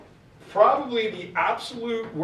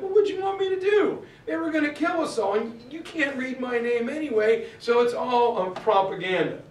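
much higher, fast, half-mock exasperation; exasperated retort; 7 semitones higher than usual. Washington answers the angry British, mock exasperated and fast. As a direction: toss it back like a cornered young officer. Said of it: "He's like".

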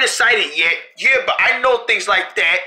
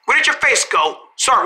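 normal talking voice

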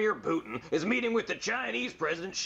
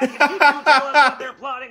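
laughing loud; 4 semitones higher, 13 dB louder than usual. Acted. Agent repeats offer, laughing.

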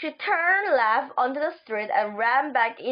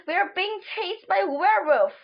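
higher-pitched urgent call, mildly raised; shouted warning; 6 semitones higher than usual. A narrator's reading. Runner warning a man of a chasing werewolf, mildly shouted. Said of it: "I shouted ahead of".

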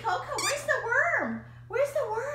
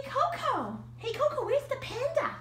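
usual speaking voice

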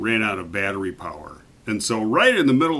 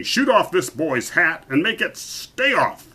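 raised, emphatic royal order; commanding king; 6 semitones higher, 4 dB louder than usual. A narrator's reading. King telling archer to shoot off the hat, commanding.

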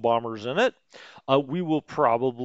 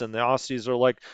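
ordinary delivery

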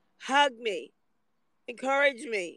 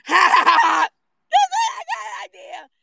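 high, loud jeering cackle into a sneering shout; mock them with a loud, high taunting laugh; 9 semitones higher, 10 dB louder than usual. Imitating scoffers who walk in, loud high jeering. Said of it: "they go".

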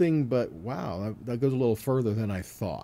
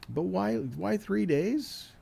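rising rhetorical question; 7 semitones higher, 3 dB quieter, slower than usual. Real, not acted.

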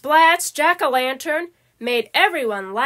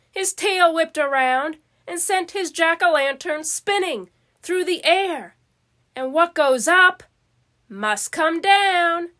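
plain reading voice

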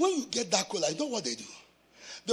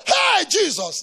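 high, loud call to passersby; retold shout; 8 semitones higher, 12 dB louder, slower than usual. Young preacher calling to the people, shouted. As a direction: call out to them as he did.

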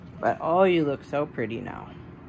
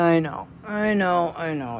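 ordinary delivery

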